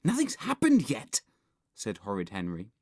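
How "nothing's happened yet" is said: high-pitched impatient retort; exasperated boy. A narrator's reading. Boy answering scared friend, exasperated. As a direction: snap back in disbelief.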